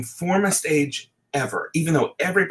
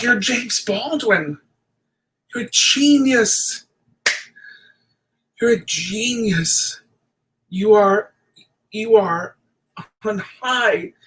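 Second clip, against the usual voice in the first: slow, higher, a touch of awe; awed admiration; 5 semitones higher, slower than usual. Retold or imitated. Thinking about James Baldwin, slow awed admiration. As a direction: marvel at him with wonder. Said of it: "I think".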